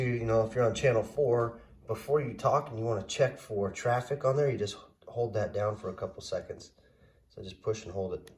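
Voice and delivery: ordinary delivery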